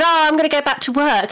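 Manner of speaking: imitating her own carefree answer to the doctor; mock breezy reply. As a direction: brush it off lightly and fast. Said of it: "I said".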